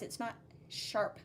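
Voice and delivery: normal talking voice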